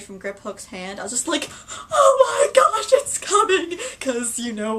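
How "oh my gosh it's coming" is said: high, loud, slow excited squeal; retold fangirl panic; freaking out. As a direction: shriek it like the moment is here. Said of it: "I was, like, freaking out".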